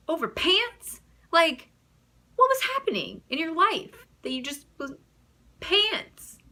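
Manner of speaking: high, loud disbelief; can't believe he wore that; incredulous. Real, not acted. Mocking her date's outfit, high incredulous disbelief.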